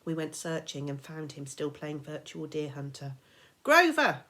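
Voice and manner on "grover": loud, high shout of his name. Acted. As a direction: call him out of the game. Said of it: "we both shouted".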